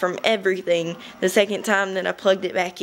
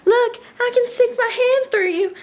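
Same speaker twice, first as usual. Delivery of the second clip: mocking ghost. Teasing a pale ghost in a high mocking voice. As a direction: tease the ghost in a squeaky high voice. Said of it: "I'd be all like".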